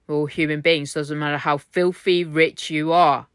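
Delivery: normal talking voice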